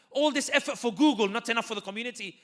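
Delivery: rally the room, push each line harder; rousing appeal. Real, not acted.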